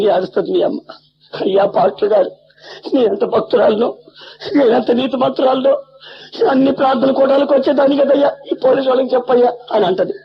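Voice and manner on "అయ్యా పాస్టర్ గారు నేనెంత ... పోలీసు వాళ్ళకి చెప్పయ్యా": higher, rising plea of a desperate mother; pleading mother. Retold or imitated. Arrested mother pleading with the pastor to help, rising plea. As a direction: beg the pastor like the distraught mother.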